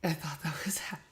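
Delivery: breathy whispered aside; whispering. Real, not acted. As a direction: drop to a whisper.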